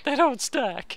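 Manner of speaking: high squeaky cartoon wail; squeaky dismay. Dismay at stacking.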